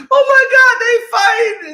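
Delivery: very high, loud mock-frightened shriek; mimicked panic. Imitating petrified girl watching the fight, high panicked shriek. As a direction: squeal it like a petrified onlooker. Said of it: "the girl is petrified".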